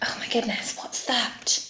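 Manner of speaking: very soft, whispery wonder; hushed alarm. Pretend. Storyteller hears something strange, hushed whispery alarm. Storyteller reacts to a new sound.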